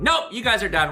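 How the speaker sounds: lightly voiced president shutting it down; FDR. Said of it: "F D R is like".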